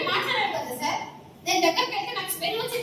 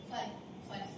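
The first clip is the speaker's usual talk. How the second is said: muttered soft apology for a slip; soft hurried sorry; quick quiet correction; 10 semitones lower, 17 dB quieter than usual. Real, not acted. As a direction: drop the voice and self-correct.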